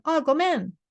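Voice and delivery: breezy everyday sorry; casual apology. Shown as a demonstration. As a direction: toss off Oh, gomen casually. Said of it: "this is a more casual".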